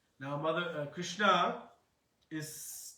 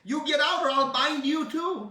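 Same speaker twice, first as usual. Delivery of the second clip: much higher and louder scolding threat; angry threat; 11 semitones higher, 12 dB louder than usual. Acted. Yashoda threatening Balaram to get out, loud angry threat. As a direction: chase him off with a threat. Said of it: "then she says".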